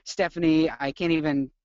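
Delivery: ordinary talk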